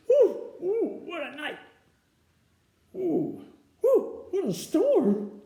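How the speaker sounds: high, chirpy little bird voice; twittering wren. Acted. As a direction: chirp it out like a tiny excited wren. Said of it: "twitters Wren".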